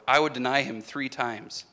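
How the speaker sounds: ordinary delivery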